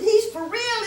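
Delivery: high, loud burst of joy; delighted shout of disbelief; excited cry. Retold or imitated. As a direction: blurt it out, thrilled. Excited realization that he's genuine.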